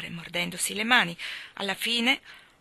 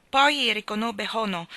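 usual speaking voice

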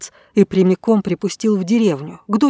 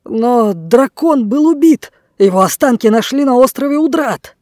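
alarmed loud protest; 4 semitones higher, 5 dB louder than usual. Acted. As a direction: cry out in disbelief. Blackbeard protests to the dragon. (испуганный громкий возглас)

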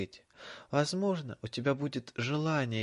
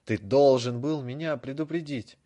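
louder, building to a yell; louder shout; 2 semitones higher, 7 dB louder than usual. A narrator's reading. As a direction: escalate into shouting. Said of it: "Голос Драко становился всё громче".

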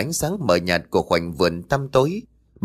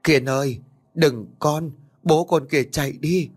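frantic shout; 5 semitones higher than usual. A narrator's reading. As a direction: scream for him to run. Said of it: "sốt ruột gào to".

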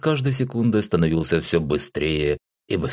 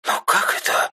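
ordinary delivery, nothing marked

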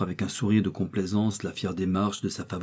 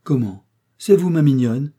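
louder, higher cry of glad surprise; delighted exclamation; 5 semitones higher, 8 dB louder than usual. A narrator's reading. Old lady greets her favourite, crying out in surprise. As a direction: exclaim with joy at the visitor. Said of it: "s'écria la vieille demoiselle".

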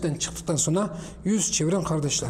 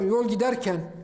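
usual speaking voice

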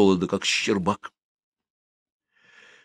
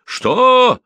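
one high, loud, outraged roar; 7 dB louder than usual. A narrator's reading. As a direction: roar it in disbelief at the cheek. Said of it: "взревел дядечка". (возмущённый рёв, высоко и громко)

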